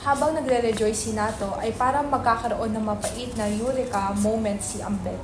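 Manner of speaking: ordinary talk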